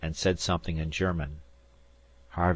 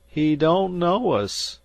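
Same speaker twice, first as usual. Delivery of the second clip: groaning; 12 semitones higher, 7 dB louder, slower than usual. A narrator's reading. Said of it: "Salter groaned".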